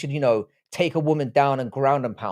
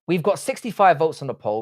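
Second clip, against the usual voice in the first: ordinary delivery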